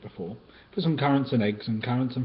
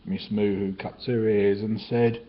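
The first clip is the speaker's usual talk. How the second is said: ordinary delivery, nothing marked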